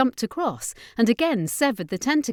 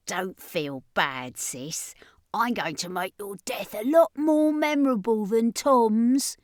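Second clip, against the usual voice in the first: menacing whisper; 3 semitones higher than usual. Acted. Brother menacing his cornered sister.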